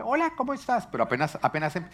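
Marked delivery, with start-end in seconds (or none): 0.0-0.9 high, light casual hello; everyday chatty greeting. Retold or imitated. Churchgoer greeting someone before prayer, imitated casual high voice. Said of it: "uno los oye normal".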